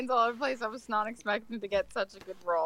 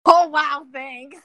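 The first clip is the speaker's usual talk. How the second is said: sarcastic wow; 5 semitones higher, 11 dB louder, slower than usual. Real, not acted. Sarcastic reaction to NPC line.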